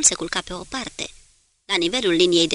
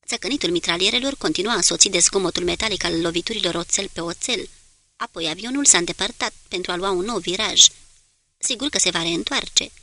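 ordinary delivery, nothing marked

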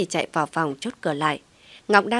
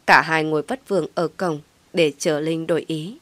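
plain reading voice

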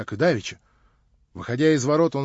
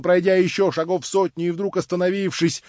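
ordinary delivery

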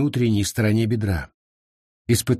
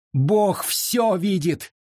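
high, drawn-out outcry; hoarse cry; 7 semitones higher, much slower than usual. A narrator's reading. Sarah rebukes the gawking crowd, a hoarse drawn-out cry. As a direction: cry it out slowly, pitched well up. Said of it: "хрипло закричала она".

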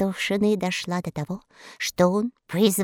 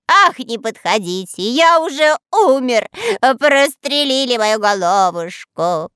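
louder theatrical groan; hammed-up dying moan; 4 semitones higher, 5 dB louder than usual. A narrator's reading. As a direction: play dead dramatically. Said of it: "стонал он". (притворно стонет)

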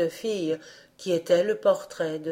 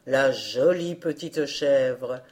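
admiring captain; 5 semitones lower, slower than usual. A narrator's reading. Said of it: "s'écria-t-il".

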